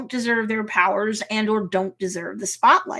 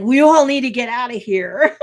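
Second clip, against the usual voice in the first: loud mock warning, laughing at the end; urgent; 2 semitones higher, 8 dB louder than usual. Pug runs up to the group, urgent. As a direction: imitate an urgent call to flee.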